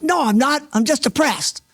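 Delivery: high-pitched, agitated mock yell; imitating an upset outburst; retold yell. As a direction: yell back in protest, as the young man did. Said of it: "And Jordan yelled".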